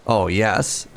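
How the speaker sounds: ordinary delivery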